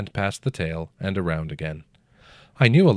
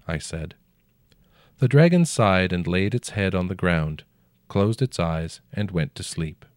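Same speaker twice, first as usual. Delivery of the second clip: usual speaking voice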